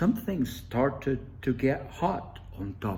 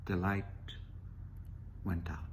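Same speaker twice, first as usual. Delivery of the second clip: softer, fading to a whisper; hushed; 5 semitones lower, 10 dB quieter, much faster than usual. A narrator's reading. Narrator hushing as the light goes out. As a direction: let it die away into a whisper.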